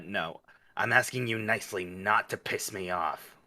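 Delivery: usual speaking voice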